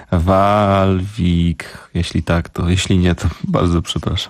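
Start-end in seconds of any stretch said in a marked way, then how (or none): 0.1-1.8 Walwik sounded out slowly, syllable by syllable; unsure pronunciation. Real, not acted. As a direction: stretch out Walwik like an unfamiliar foreign name. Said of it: "to jak się to czyta".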